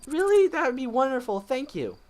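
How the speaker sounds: put-on high, loud, eager young girl; high girly voice. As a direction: squeak it out excitedly. Voices heroine Hana.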